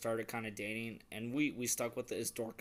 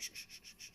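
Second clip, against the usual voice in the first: soft whispered shushing; 14 dB quieter, faster than usual. Retold or imitated. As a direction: hush her gently. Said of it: "I'm like".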